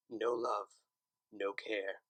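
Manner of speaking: ordinary delivery, nothing marked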